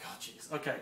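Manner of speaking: breathy whisper of resignation; whispered groan. Retold or imitated. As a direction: whisper it, already exasperated. Reacting to another spam call.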